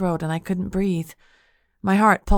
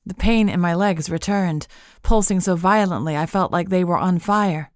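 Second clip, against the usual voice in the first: ordinary delivery, nothing marked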